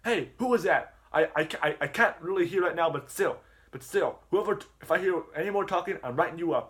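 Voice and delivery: teacher threatening to write students up; mock teacher voice. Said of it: "Jackie Chu's like".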